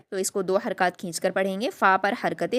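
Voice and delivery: usual speaking voice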